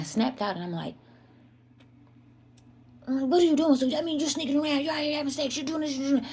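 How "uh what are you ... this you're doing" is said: high, outraged mother yelling at her son; mother finding hickeys on her son, outraged and higher; angry mother. Retold or imitated. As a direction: let it fly, shocked and accusing. Mother confronts son over hickeys.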